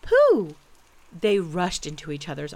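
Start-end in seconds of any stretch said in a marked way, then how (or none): 0.0-0.5 high, loud delighted shout; overjoyed cry. A narrator's reading. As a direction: shout the name on finding your lost friend. Joyful reunion with lost friend.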